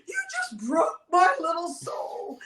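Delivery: hurt fan. Retold or imitated. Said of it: "He's like".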